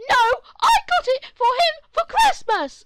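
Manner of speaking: high, very loud, slow shouted lie; shrill lie. Acted. The other mom shouting a lie at the family. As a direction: scream the lie. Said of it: "mother lies loudly".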